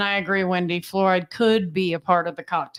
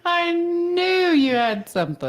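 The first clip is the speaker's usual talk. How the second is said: laughing; 11 semitones higher, 3 dB louder, slower than usual. Real, not acted. Laughing after his cadence joke.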